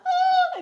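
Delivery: a high shriek of alarm; short high yelp of fright; retold panicked scream. Cows banging the car, high frightened shriek. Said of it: "I'm screaming".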